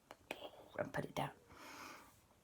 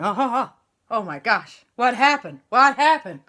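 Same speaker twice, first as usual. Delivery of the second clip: loud, high confused fuss; flustered bewilderment; bewildered El Fuego; 5 semitones higher, 9 dB louder than usual. Acted. El Fuego recovering, loud confused questions.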